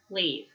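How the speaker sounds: "Leave" ending on the V sound; silent E. Shown as a demonstration. Teacher models for students, "Leave" with the final E silent. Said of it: "It's a silent E there".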